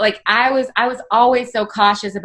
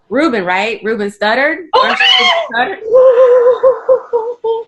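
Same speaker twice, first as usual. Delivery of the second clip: high, loud and slow, gushing fan; mock starstruck shout; 14 semitones higher, 5 dB louder, slower than usual. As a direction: shriek the wrong name like a dazzled fan. Flirty fan calling him wrong name.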